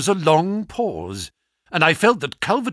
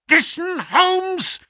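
high gloating villain; 11 semitones higher, slower than usual. Acted. Smith gloating over dying Holmes.

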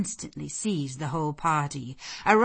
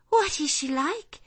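put-on high, louder young lady's question; eager question; 11 semitones higher, 5 dB louder than usual. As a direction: ask with keen curiosity. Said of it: "inquired the Missus Eshton in a breath".

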